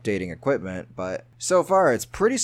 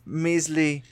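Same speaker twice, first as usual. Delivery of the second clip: a hammed-up British charity-appeal accent; overdone posh British plea; mock British accent; 4 semitones higher than usual. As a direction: plead for donations in a put-on British accent. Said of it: "Al loves my British accents".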